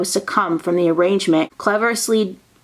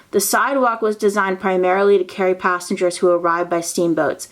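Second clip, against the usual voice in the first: ordinary talk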